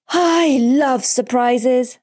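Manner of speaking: high, long-stretched cry of joy; slow, high-pitched childish rapture; ecstatic. A narrator's reading. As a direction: draw it out like a thrilled boy. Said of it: "says Pablo ecstatically".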